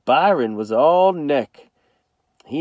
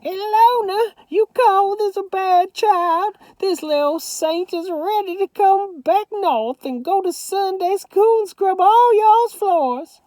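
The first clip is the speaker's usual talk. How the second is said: shouting Grandma Sands; 3 dB louder than usual. A narrator's reading. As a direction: call down the phone like a proud granny. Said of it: "she shouted".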